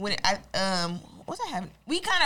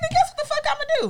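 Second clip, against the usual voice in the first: defiant shout; 6 dB louder than usual. Acted. Defiant rant about going back.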